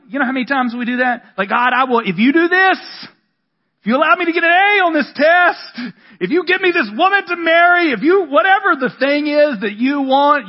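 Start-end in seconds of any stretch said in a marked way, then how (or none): 1.5-10.5 put-on higher, louder, begging God like a child; mock pleading prayer, pitched up and loud; pleading bargain prayer. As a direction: bargain with God like a desperate kid.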